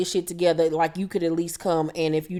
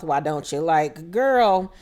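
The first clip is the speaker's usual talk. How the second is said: usual speaking voice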